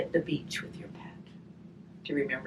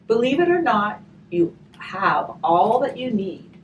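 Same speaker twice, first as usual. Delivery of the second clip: gentle storybook reading, slow on the rhymes; reading to children; 3 semitones higher, 5 dB louder than usual. Acted. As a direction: read the rhyme aloud to the children. Reading a rhymed picture book aloud.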